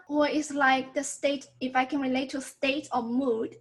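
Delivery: ordinary talk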